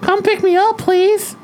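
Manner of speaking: high, slow fake girl voice; high sweet girl on the phone; girly voice. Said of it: "this is Kylie Stinson".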